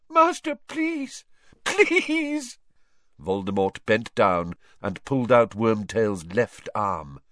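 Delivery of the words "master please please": high, slow, pained pleading; desperate begging. Acted. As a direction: beg through tears. Wormtail begs in pain.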